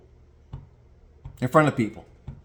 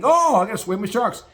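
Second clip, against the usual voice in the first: mock liberal idealist; 6 semitones higher than usual. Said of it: "Liberal-minded thinking".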